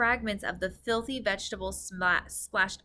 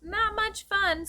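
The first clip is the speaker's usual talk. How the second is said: put-on 1 little girl; 12 semitones higher, slower than usual. A narrator's reading. Sophie's voice.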